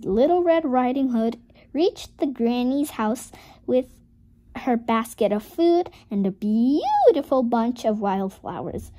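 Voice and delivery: ordinary delivery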